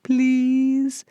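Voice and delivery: high, stretched-out begging whine; whining plea. Retold or imitated. Parenting host mimicking a child begging for a snack, drawn-out whine. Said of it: "especially the annoying kind".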